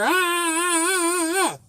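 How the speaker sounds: high pained "ah" cries in mock imitation; imitated pained cries. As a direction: mimic her yelping as the bandage comes off. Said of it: "and I hear".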